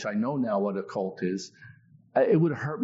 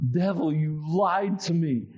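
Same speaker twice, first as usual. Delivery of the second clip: defiant rebuke; 6 semitones higher, slower than usual. Retold or imitated. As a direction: rebuke him slowly, with force.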